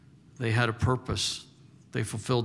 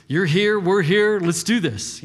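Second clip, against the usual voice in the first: loud, high rallying cry; acted-out eager disciple urging everyone on; fired-up rallying; 8 semitones higher, 7 dB louder than usual. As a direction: rouse the group for action, raised voice. Voicing disciples eager to take over.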